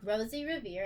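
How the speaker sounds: ordinary talk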